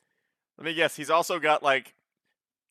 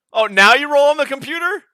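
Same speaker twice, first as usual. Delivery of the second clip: much higher, louder disbelief; surprised outcry; 13 semitones higher, 12 dB louder than usual. Real, not acted. Player reacting to the game master rolling on the computer, surprised. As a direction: cry out in disbelief.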